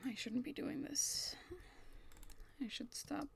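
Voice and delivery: soft guilty whisper; hushed guilty whisper while snooping. Acted. Sneaking through files.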